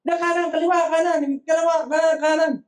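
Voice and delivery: high-pitched, urgent calling of directions; piñata game onlookers. Retold or imitated. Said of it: "Maraming voices". (sumisigaw ng direksyon sa palaro, mataas ang boses)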